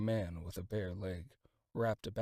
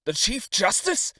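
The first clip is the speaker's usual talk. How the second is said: 1 surprised question; 13 semitones higher, 13 dB louder than usual. A narrator's reading. Brother asks in surprise.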